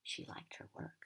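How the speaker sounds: usual speaking voice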